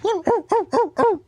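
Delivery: high-pitched startled dog yelps; dog impression. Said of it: "I do a good dog impression".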